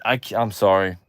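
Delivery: ordinary talk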